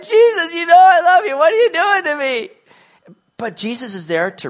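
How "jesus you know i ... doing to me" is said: hammed-up exasperated outburst, high and loud; annoyed. Peter protests to Jesus at being questioned repeatedly, loudly annoyed. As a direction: protest loudly with the pitch pushed right up. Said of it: "He's getting a little annoyed".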